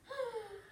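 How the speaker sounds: dramatic gasp. Acted. Gasp in the story problem.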